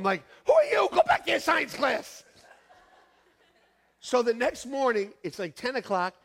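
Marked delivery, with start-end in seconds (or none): 0.5-2.2 indignant retort. Retold or imitated. Mocking the young doctors.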